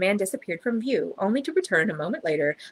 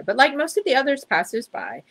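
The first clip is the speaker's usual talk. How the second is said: usual speaking voice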